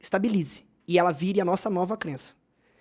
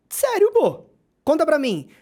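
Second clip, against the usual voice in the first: high, loud burst of disbelief; acted amazement; 9 semitones higher, 5 dB louder, slower than usual. Boss reacts to an employee's news, acted amazement. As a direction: leap up in pitch, eager to hear more.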